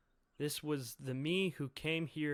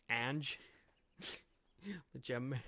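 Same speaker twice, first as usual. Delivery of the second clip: normal talking voice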